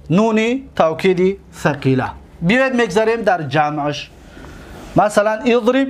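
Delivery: ordinary delivery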